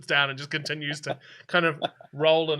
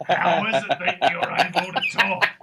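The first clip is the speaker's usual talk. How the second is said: eccentric, incredulous questioning with laughter; hammed-up loony wonder; intense old man; 3 semitones higher than usual. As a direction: get right in their face, baffled. Old man right in face.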